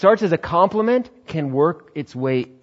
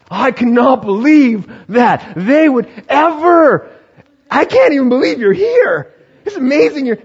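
hammed-up amazement; 12 semitones higher, 9 dB louder than usual. Churchgoer gushing at the newcomer, hammed-up high amazement. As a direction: overdo the delight at seeing them.

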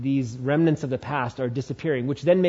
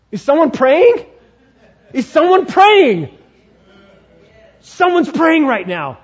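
high loud startled demon voice; alarmed enemy; 11 semitones higher, 10 dB louder than usual. Acted. The enemy catching the prayers against him, high and alarmed. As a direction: panic at the sound of prayer. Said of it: "it's almost like".

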